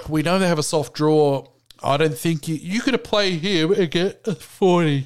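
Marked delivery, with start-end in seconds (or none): 2.5-5.0 yawning. Real, not acted. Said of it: "So excuse my yawning".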